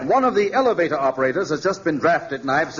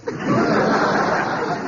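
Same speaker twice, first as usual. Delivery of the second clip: laugh. Acted. Mayor laughs.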